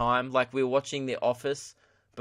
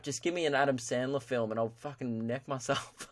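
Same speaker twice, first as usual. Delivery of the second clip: normal talking voice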